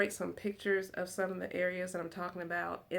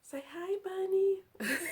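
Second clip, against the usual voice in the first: put-on baby talk; 12 semitones higher, slower than usual. Talking to toy bunny.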